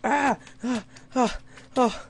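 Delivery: repeated high loud screams of fright; scared screams. Real, not acted. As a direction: shriek ah in real panic. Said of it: "I'm scared".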